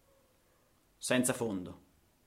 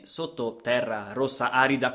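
plain reading voice

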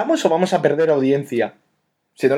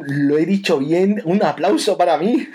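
laughing; 6 semitones higher than usual. Real, not acted. Proud after saying it right.

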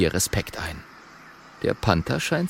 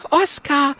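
high, delighted greeting; high-pitched happy critter; joyful. A narrator's reading. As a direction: greet a friend in a glad high voice. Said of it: "sagt Mathilda freudig".

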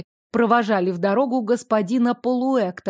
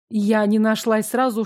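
ordinary delivery

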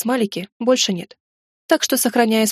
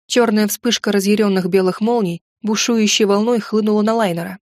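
ordinary delivery, nothing marked

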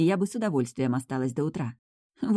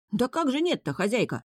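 higher, indignant protest; 8 semitones higher than usual. A narrator's reading. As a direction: object with hurt disbelief. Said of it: "возмутился парень".

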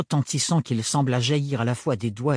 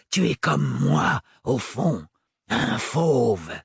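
menacing sans-peur; 3 semitones higher, slower than usual. Acted. Sans-peur taunts Octavio.

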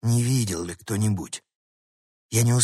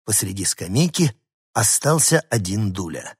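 ordinary delivery, nothing marked

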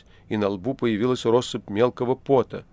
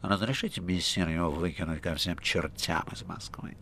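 respectful; 5 semitones lower, 9 dB quieter than usual. A narrator's reading. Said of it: "добавил почтительно".

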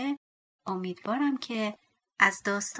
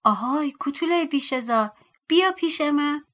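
loud, raised-pitch summons; excited shout; 6 semitones higher, 7 dB louder than usual. A narrator's reading. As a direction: call out eagerly for the dwarf to come. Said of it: "هیجان زده فریاد".

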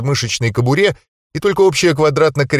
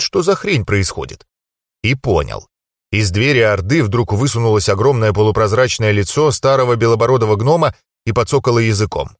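ordinary delivery

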